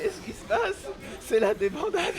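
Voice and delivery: high, stunned exclamation; disbelief. Real, not acted. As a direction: react as it collapses. Caster in disbelief.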